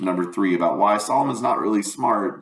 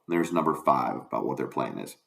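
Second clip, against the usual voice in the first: usual speaking voice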